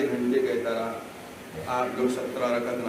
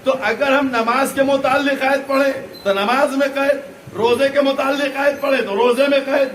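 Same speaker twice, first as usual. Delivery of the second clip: high, loud insistent oratory; impassioned preaching; 10 semitones higher, 8 dB louder than usual. Real, not acted. Preacher arguing his point to the majlis, full-force preaching. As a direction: drive each parallel clause home at full voice.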